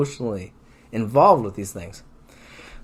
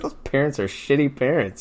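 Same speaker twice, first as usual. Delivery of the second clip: ordinary talk, nothing marked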